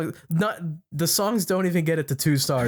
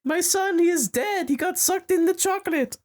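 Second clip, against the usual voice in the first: put-on German accent. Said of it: "I can't do a German accent".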